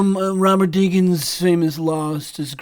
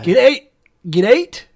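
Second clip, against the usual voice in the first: louder Scottish "out" drill; Scottish accent; 4 semitones higher, 6 dB louder than usual. Shown as a demonstration. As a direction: practise the Scottish "out". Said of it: "It's the out".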